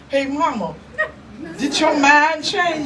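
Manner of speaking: mock Tony asking, then answering him; teasing son. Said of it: "Tony be like".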